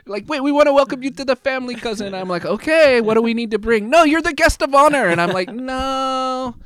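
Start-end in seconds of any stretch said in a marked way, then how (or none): 0.3-2.1 much higher, fast hammed-up Filipino accent; loud, high comic Filipino-accent welcome. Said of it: "Darren doesn't have an accent, I'm gonna tell you, I do now". 2.4-3.9 high, loud mock Filipino accent; loud comic Filipino lilt. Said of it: "I do now". 3.9-5.1 put-on very high, loud Filipino accent; loud singsong Filipino accent. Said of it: "I do now". 5.5-6.6 high mock Filipino "No."; Filipino accent. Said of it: "I do now".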